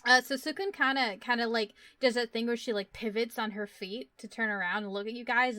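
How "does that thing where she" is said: ordinary delivery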